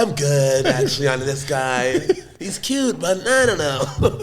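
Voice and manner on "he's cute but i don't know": put-on a timid girl voice, high and small; weak girly voice. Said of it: "that weak-ass voice for a girl".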